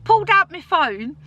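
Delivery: high, breathless excited retelling. Real, not acted. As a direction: bubble over with excitement.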